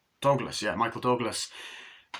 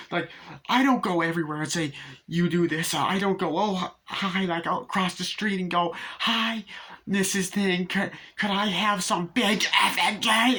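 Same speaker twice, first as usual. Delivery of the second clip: high-pitched, mounting mock tirade; imitated rage rant; 7 semitones higher than usual. Imitating Annie ranting at Paul about swearing, mock fury. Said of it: "the rage scene is scary".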